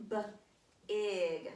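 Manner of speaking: teacher repeating the word for the class; sounding out. Shown as a demonstration. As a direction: sound out each letter. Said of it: "let's write that word".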